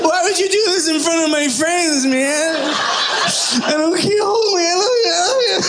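mock sobbing. Son mock sobbing at graduation to his dad. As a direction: wail it like a crying kid.

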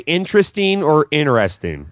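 "interesting" said two ways, the second looser; two pronunciations. Shown as a demonstration. Host comparing ways to say a word, contrasting pronunciations of "interesting". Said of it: "It's like if you say".

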